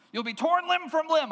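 high, loud mock-royal threat; angry king's threat. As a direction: threaten them as an enraged king. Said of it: "then he gets angry".

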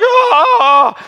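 high, loud comic wail; mock wail. Soul wailing at being scolded, loud mock wail. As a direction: let out a sulky cry. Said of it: "even all the more like".

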